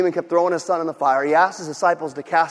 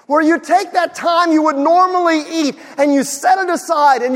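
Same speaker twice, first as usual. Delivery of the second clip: higher, louder, fervent sermon voice; full-force preaching; 8 semitones higher, 6 dB louder than usual. Acted. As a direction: drive it home to the congregation.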